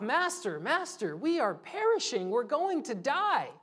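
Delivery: high, urgent cry of frightened fishermen; a touch of mock panic, pitched high; panicked plea. Disciples wake Jesus in storm.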